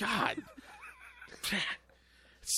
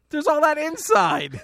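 shocked outcry; 8 semitones higher, 4 dB louder than usual. Real, not acted. Shocked at fart smell.

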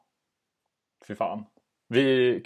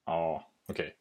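ordinary talk, nothing marked

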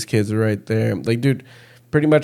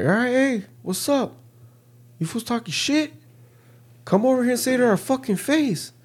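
very high-pitched confrontational call-out; retold challenge; 13 semitones higher, 3 dB quieter than usual. Player calling out the fools talking shit, high-pitched retold challenge. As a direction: call them over for a face-off, pitched way up.

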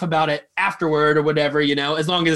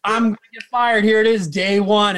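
higher, louder mock panic; dramatized panicked wail, pitched up; acted freaking out; 5 semitones higher, 4 dB louder, slower than usual. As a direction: replay your dread of being fired. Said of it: "I'm freaking out".

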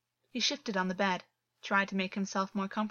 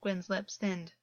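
ordinary delivery

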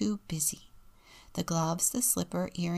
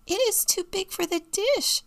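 put-on very high small child voice; high-pitched child objecting; little boy's voice; 13 semitones higher, 4 dB louder than usual. A narrator's reading. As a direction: pipe it up high like a little kid. Story reader voices little Robbie.

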